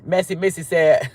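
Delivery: ordinary talk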